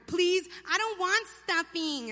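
put-on high, slow whining kid; whiny cousin. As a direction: drag it out like a sulky child at dinner. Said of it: "A whiner".

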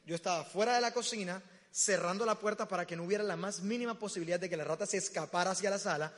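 usual speaking voice

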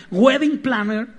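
high, loud flourish; showy announcement. Acted. Preacher showing off the English job title, showy announcement. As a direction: announce the title as the last word in importance. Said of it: "así como que, pues, ya".

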